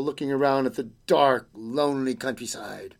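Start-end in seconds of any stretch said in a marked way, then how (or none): none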